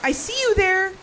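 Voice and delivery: calling out. Acted. Calling out to hidden figure.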